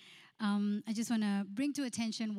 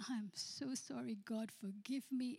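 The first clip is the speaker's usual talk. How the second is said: tearful repentance; 2 semitones higher, 9 dB quieter than usual. Retold or imitated. Groom repents in tears.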